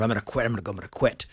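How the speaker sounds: psyching himself up in a low murmur; a nervous kid rehearsing quietly to himself; nervous self-talk. Retold or imitated. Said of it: "I'm getting up the nerve".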